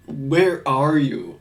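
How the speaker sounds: slow, drawn-out mock Ohio accent; Alonzo's thick Ohio accent. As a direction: lightly imitate a thick Ohio accent, very slowly. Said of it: "Some sort of Ohio accent".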